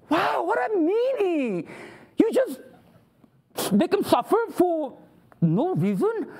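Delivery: put-on high-pitched childish protest; put-on scandalized whine; mock indignation. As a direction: object like an affronted complainer. Acting objector mocking God's will.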